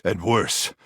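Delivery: ordinary talk, nothing marked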